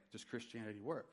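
ordinary talk, nothing marked

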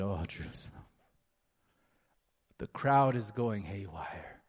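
a hint of grieving; mild lament. Jesus weeping over the city in a low, mournful voice. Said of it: "He actually cries".

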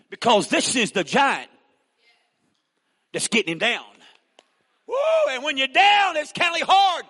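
loud, fervent preacher in full flow; preaching at full force. Real, not acted. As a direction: drive the sermon home, full voice. Sustained climactic sermon delivery.

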